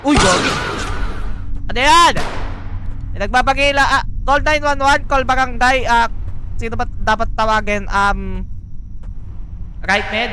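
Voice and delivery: high, loud alarmed yelling; panicked shouting. Real, not acted. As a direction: panic at an intruder. Intruder appears, panics.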